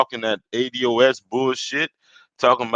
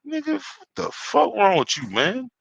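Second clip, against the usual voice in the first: high indignant rant; angry call-out; 12 semitones higher than usual. Real, not acted. Calling out O'Shea, high indignant rant. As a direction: demand it with disbelief.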